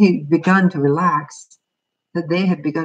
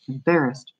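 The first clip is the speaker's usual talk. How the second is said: plain reading voice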